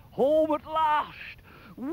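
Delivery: high, loud, stretched-out glee; joyful cry. Acted. Jack sliding home at last, joyful whoop. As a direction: call it out, then squeal down the slide.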